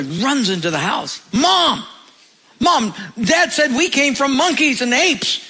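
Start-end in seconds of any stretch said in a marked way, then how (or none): 1.3-5.4 put-on excited little boy. Boy runs in shouting.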